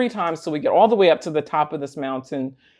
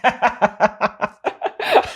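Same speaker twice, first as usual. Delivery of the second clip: disbelieving laugh; 6 semitones higher than usual. Real, not acted. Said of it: "I literally laughed just like that".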